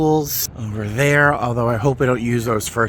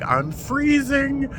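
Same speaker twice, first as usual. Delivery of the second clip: shivering quote; 12 semitones higher, much slower than usual. Retold or imitated. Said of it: "a young lady over there who was like".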